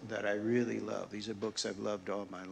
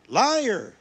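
louder and higher second liar; the cry climbs, even more enraged; shouted accusation; 12 semitones higher, 13 dB louder than usual. Acted. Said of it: "he shouted".

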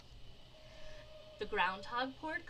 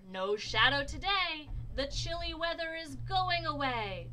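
high, loud proclamation; storybook mayor rejoicing; jubilant announcement; 7 semitones higher, 7 dB louder than usual. A narrator's reading. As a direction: announce the good news to the whole town. Mayor announces good news.

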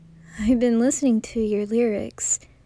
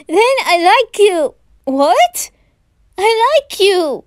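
loud, higher, nervous love confession; blurted confession; 8 semitones higher, 9 dB louder than usual. Acted. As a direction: force the confession out at last.

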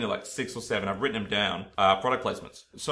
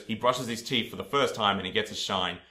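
ordinary talk

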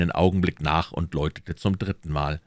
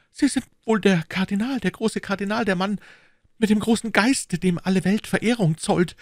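higher, louder grovelling praise; fawning Bonacieux; 3 dB louder than usual. Acted. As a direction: kneel and flatter him. (kriecherisch schmeichelnd)

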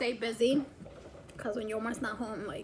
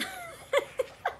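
squealing laugh, much higher; high burst of laughter. Real, not acted. Mom cracking up at her daughter's answer, high laugh. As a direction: let a high giggle burst out.

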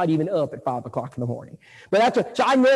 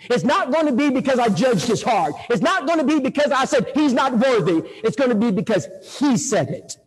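raised, forceful pulpit preaching; 6 semitones higher than usual. Acted. Preacher driving the point home to the congregation, full force. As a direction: preach it to the brethren.

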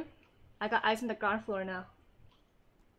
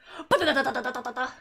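gasp into laughter; 6 dB louder than usual. Real, not acted. Sudden escape in the game.

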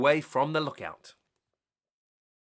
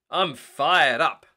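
loud, slow rallying call; 9 dB louder, much slower than usual. Acted. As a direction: call it out slowly to pump everyone up. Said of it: "Marshall called out".

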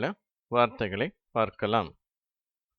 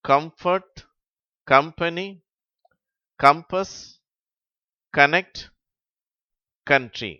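rising run-on list; reading word list quickly; 5 semitones higher, 7 dB louder, slower than usual. Shown as a demonstration. Teacher reading the seventh row to pupils. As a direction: read the row briskly. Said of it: "நான் இடைவெளி விட்டு வாசிக்கிறேன்".

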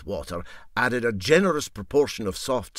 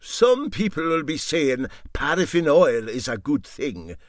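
sly captain; 6 semitones higher, 7 dB louder than usual. Acted. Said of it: "suggested the captain".

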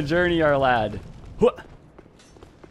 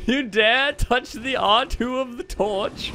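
mock outrage; 10 semitones higher, slower than usual. Mock indignation.

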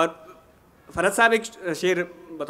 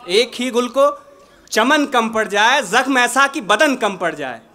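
measured, projected ghazal recitation with pauses; poetry recitation; 2 semitones higher, 5 dB louder than usual. Acted. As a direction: recite the couplet to a mushaira audience.

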